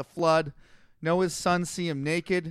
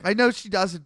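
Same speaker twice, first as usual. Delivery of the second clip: usual speaking voice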